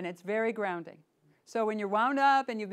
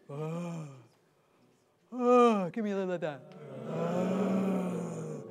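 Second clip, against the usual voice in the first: Neanderthal voice; 2 semitones lower, much slower than usual. Shown as a demonstration. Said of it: "the first character is the Neanderthal".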